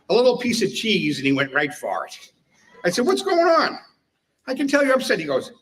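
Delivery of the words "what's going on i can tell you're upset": high and sing-song, fake concern; mock-sympathetic high voice. Teller baiting the annoyed chief, mock-sympathetic high voice. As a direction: feign concern to bait the chief, pitched way up.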